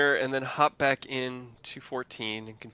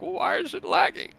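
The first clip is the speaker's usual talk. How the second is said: mocking whiny question; 4 dB louder than usual. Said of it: "people are like".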